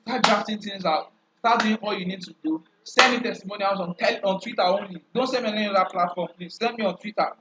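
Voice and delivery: ordinary talk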